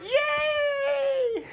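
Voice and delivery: put-on high squealing kid cheer; excited cheer. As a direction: shout it like a thrilled preschooler. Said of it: "They'd be like".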